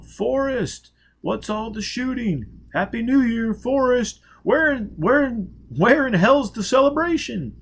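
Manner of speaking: high, loud festive calls; calling out from below. Acted. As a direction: shout up the stairs to a friend. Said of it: "Voices below began to call".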